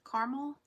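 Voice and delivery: "Caramel" as CAR-mul, middle A dropped, rising; two-syllable pronunciation. Shown as a demonstration. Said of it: "There's an A in the middle".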